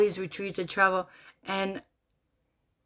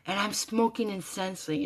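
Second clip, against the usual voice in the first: ordinary talk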